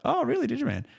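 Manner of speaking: high, exaggerated disbelief; teasing fake astonishment at the stranger; mock surprise. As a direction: jump way up in pitch, playing amazed.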